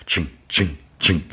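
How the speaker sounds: spaced metallic "ching" sounds; imitated clanking footsteps. Imitating K two S O walking down the bridge, clanking. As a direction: mimic a droid's steps, one ching per step.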